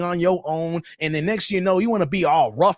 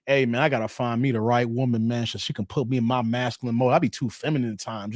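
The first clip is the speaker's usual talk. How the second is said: lower, fast mock dude talk; mock guy voice; 8 semitones lower, 3 dB quieter, faster than usual. Host imitating a man seeking a woman, low and fast. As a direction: parody a guy complaining, quick and low. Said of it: "You never hear guys saying".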